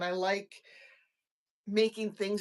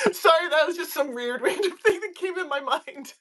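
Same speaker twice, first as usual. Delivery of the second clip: laughing; 9 semitones higher than usual. Real, not acted. Laughs at own weird idea.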